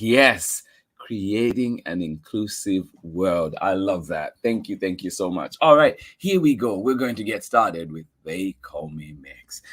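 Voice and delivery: ordinary talk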